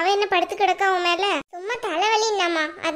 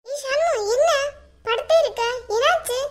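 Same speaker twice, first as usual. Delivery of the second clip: high-pitched, questioning call; concerned calling; 6 semitones higher than usual. Acted. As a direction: call out to rouse her, concerned. Worried call to someone lying down.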